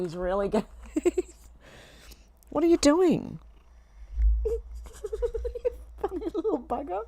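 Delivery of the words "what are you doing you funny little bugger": laughing, high-pitched scolding of a pet; high, giggly and affectionate to a naughty pet; helpless amusement. Real, not acted. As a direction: squeak it out through real giggles. Laughing at the cat's antics.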